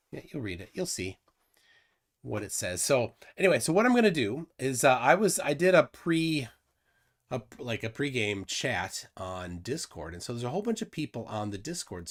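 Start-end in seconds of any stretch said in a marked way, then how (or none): none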